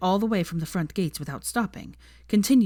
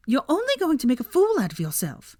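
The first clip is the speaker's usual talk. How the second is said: high female voice; 9 semitones higher, 4 dB louder than usual. A narrator's reading. Pansy warning Draco.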